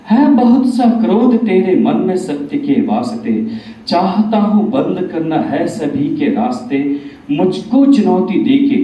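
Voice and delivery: declamatory verse with strong openings falling away at line ends; Bhagwan in recited verse. Acted. Bhagwan replying to Kaliyug after listening silently, declamatory recitation. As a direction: recite as a deity answering a challenger in measured rhyme. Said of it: "यहाँ भगवान कलयुग से बताते हैं".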